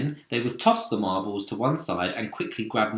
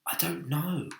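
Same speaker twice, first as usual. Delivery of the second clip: softly; 6 semitones higher, 5 dB quieter than usual. Acted. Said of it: "James answered softly".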